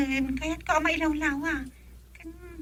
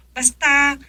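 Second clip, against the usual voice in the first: sudden louder insistent word; loud insistence; 2 semitones higher, 9 dB louder than usual. Acted. Wife insisting to her husband, suddenly loud. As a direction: punch it out to end the argument.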